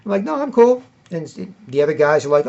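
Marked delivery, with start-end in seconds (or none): 0.2-0.8 confident insistence. Retold or imitated. Insisting he is cool at party.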